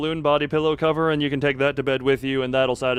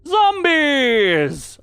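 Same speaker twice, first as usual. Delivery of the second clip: high, loud, drawn-out shout; mock zombie alarm; 13 semitones higher, 6 dB louder than usual. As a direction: cry it out in hammed-up alarm. Mock announcement of zombies in game.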